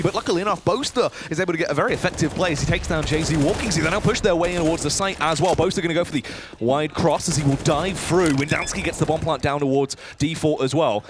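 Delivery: brisk play-by-play call; esports caster. Real, not acted. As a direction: keep the round moving. Caster calls live round.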